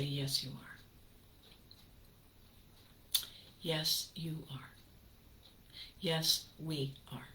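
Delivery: soft, slow, near-whispered comfort; tender self-reassurance; reassuring whisper. Acted. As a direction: hug the little voice with it. Reassuring little voice.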